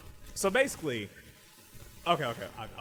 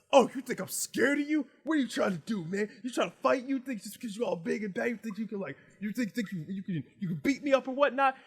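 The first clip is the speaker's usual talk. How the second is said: higher, indignant challenge; confrontational taunt; 10 semitones higher, 4 dB louder than usual. Acted. Fox squaring up to Legoshi, indignant and taunting. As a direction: goad him into a fight.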